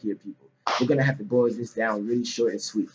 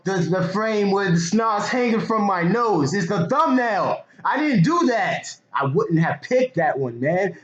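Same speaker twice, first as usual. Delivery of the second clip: excited; 9 semitones higher, 4 dB louder, slower than usual. Real, not acted. Excited story about crying video.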